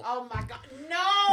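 high, loud, stunned no; shocked no. Real, not acted. Cohost hearing the bottle story, shocked. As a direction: blurt it out in disbelief.

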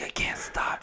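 hushed whisper in a hunting blind; boy whispering to his dad in the blind. Retold or imitated. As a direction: whisper so the buck won't hear. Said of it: "'cause they're being quiet".

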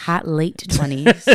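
"late" leaned on slowly, set apart; stress on late. Real, not acted. Correcting a co-host about being in her twenties, stress on "late". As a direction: lean slowly and deliberately on the word "late". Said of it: "Emphasis on the late".

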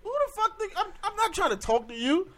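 high, loud exasperated telling-off; reenacting going off on her, loud and high; retold angry outburst. To the woman blocking the Sprint store door, loud angry rant. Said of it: "I was like".

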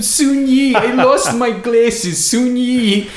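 fretful New York Woody Allen impression. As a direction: call out in a panic like Woody Allen. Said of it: "imagine Woody Allen just running".

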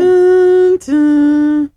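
two-note chime, sung flat and loud; airport chime imitation. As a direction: imitate the airport ding-dong. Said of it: "That's your boarding call".

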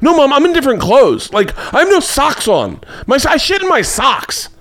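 put-on high-pitched whiny-kid indignation; retold outburst, voice pushed way up; exasperated protest. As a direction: plead with mom to grasp the disaster. Retold exasperated plea to mom.

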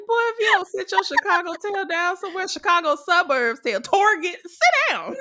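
high, fast, sassy telling-off; sassy scolding. Retold or imitated. Fan playfully scolding Johnny, high sassy telling-off. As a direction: scold him like a sassy auntie. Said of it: "I'm like".

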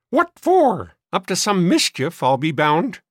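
very high, loud suspicion; querulous old maid. A narrator's reading. Sister suspecting boys of mischief, querulous. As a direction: screech it like a fussy spinster.

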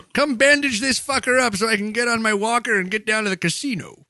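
high, hammed-up old-timer voice; injured old caller. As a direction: demand help like a comic old caller. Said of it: "Can you imagine the call?".

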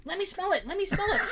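high, eager begging to smell it; excited mom. Retold or imitated. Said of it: "she's super excited".

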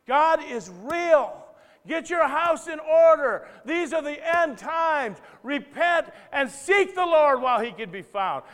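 slow booming billboard shout; shouted proclamation. Acted. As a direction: proclaim it loud to the whole world. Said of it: "that shouts".

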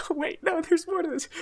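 high, giggly self-interruption; laughing. Real, not acted. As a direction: catch yourself while still laughing.

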